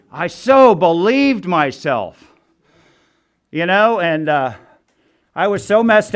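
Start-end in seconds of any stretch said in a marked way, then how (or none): 0.1-2.3 "so" stretched and leaned on, very slow; slow, stressed so. Real, not acted. Speaker at recovery meeting, repeats with stress on "so". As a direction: dwell on "so" in "I so believed myself".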